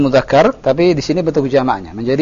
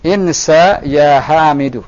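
slow, measured phrase for repeating; model reading; slower than usual. Shown as a demonstration. Teacher reading together with a student, slow model reading. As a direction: draw it out, falling at the end. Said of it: "kita baca sama-sama".

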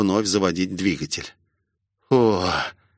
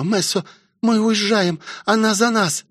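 высокий встревоженный девичий голос; торопи раненого высоким голосом; взволнованная мольба; 10 semitones higher than usual. A narrator's reading. Роузи торопит раненого друга. (встревоженно, высоко)

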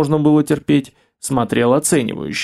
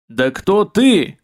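fierce bark; 4 semitones higher, much slower than usual. Acted. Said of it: "рявкнул мрак люто".

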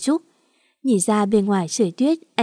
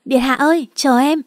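higher-pitched, restrained narrated yell; 4 semitones higher, 4 dB louder than usual. A narrator's reading. Servant calling after his highness to wait, a mild yell. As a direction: call after him to wait. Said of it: "Quay lại gào lên".

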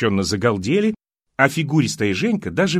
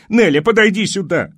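full-voiced high call across the yard; shout with all her might; 8 semitones higher, 6 dB louder than usual. A narrator's reading. Woman in towel turban shouting for Nelly. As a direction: yell the name as loud as she can. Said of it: "изо всех сил закричала".

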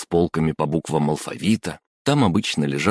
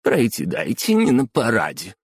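snorting; 5 semitones higher than usual. Acted. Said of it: "фыркнула она".

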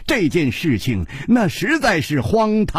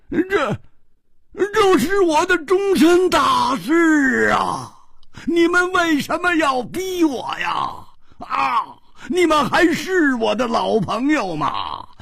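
much higher, shouting, stretched slow on 终身大事; desperate outcry; 10 semitones higher than usual. Acted. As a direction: wail in aggrieved protest. Friend protests being forced. (委屈地高声大喊)

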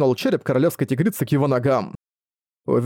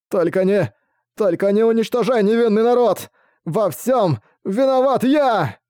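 desperate plea; 8 semitones higher than usual. Acted. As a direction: plead for your people. King begs for his people.

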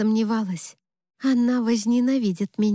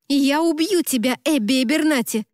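raised, higher indignant threat; angry cry; 5 semitones higher than usual. A narrator's reading. Best friend threatening the bride over the phone, angry cry. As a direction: cry out without full shouting. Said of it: "закричала Америка".

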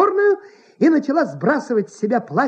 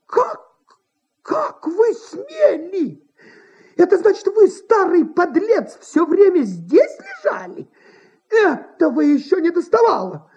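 much higher, shrill-free indignant scolding; indignant outrage; 9 semitones higher than usual. A narrator's reading. Actress berates the manager hiding under her sofa. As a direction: scold the old scoundrel with outrage. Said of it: "возмутилась она".

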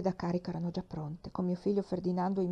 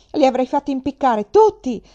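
loud, high, furious threat; beside herself with rage; 5 semitones higher, 12 dB louder than usual. Acted. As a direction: scream the threat from the ramparts. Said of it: "esclamavo fuori di me". (minaccia urlata, fuori di sé, forte e acuta)

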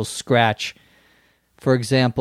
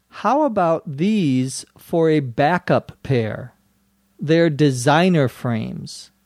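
"How about these for a backup pair? They're designer frames." read slowly and clearly; slow, careful reading of "How about these for a backup pair?"; slower than usual. Shown as a demonstration. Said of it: "this time at a normal speed".

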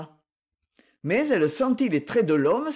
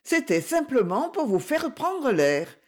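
high-pitched, mildly affronted justification; understated indignation, pitched up; indignant protest; 7 semitones higher than usual. A narrator's reading. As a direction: defend yourself against the accusation. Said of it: "s'insurgea José".

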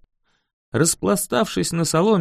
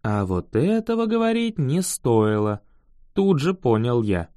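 ordinary delivery

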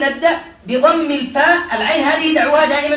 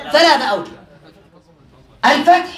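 usual speaking voice

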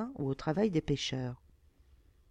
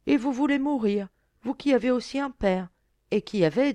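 high, louder reproach; pleading reproach; 7 semitones higher, 6 dB louder than usual. A narrator's reading. Fisher girl to a lord who wishes to die, reproachful. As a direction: confront him with an earnest appeal.

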